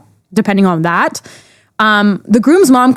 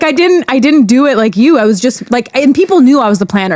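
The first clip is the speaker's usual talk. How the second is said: usual speaking voice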